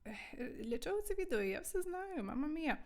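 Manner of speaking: light, high-voiced mock protest; imitated protesting student. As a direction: play a student objecting to the lesson. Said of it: "Скажете".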